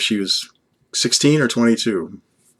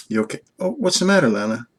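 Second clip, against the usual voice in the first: tender concern; faster than usual. Pretend. Comforting Lana, who is crying.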